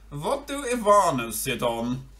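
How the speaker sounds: a bold ibex asking. Acted.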